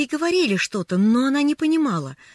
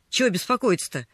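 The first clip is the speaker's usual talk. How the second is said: plain reading voice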